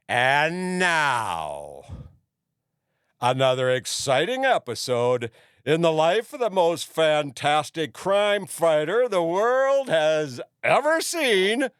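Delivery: loud, high, slow show announcer hype; yelled announcement. Retold or imitated. Performer yelling into the microphone to a bar crowd, yelled announcement. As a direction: build it up like a serial intro, ending high. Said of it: "and I'd yell".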